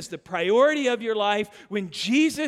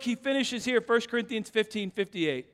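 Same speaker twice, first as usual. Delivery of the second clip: usual speaking voice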